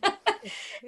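high laugh. Real, not acted. Laughs while imitating friend.

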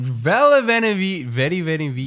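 playfully mangle "Vedi Venevici"; hammed-up tongue twister; mock stumbling. Mocking the botched hard word.

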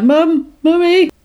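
high-pitched mock cry for mum; calling for mum. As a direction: imitate a frightened girl calling out. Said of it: "she starts calling for her mum".

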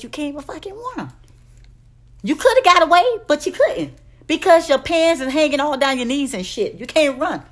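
exasperated ranting shout; rising rant. Real, not acted. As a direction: let the rant boil over into a yell. Ranting about sagging pants.

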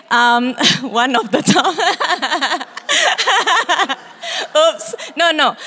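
high giggly laughter breaking in; giggling through words. Real, not acted. Pastor teasing the congregation about her husband, giggling. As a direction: laugh it off, embarrassed.

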